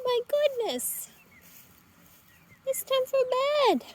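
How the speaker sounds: high, lilting coaxing call to the hens; sing-song chicken call. Real, not acted. Calling chickens to bed.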